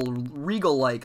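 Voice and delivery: ordinary delivery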